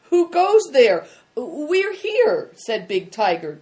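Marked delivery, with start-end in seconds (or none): 0.1-1.1 sentry's sharp challenge, high and loud; sharp call. A narrator's reading. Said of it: "a sharp voice called out". 1.4-2.5 high, loud, flustered reply; confused. A narrator's reading.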